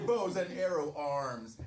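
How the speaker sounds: ordinary talk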